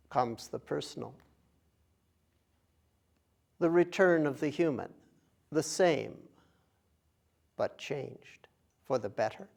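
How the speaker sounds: measured phrases with long, spacious pauses; unhurried contemplative recitation, phrase by phrase; guided meditation. Acted. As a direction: guide them gently, leaving silence between phrases. Leads a meditation.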